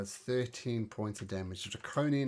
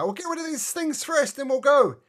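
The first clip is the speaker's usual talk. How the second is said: high-pitched, loud character voice; high voice building louder at the end; shouted character reply; 13 semitones higher, 10 dB louder than usual. Acted. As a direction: call back over the fight, voice way up.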